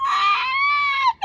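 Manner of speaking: very high, loud shriek; piercing little-bear scream; cub's screech. Acted. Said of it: "Baby Bear screeched".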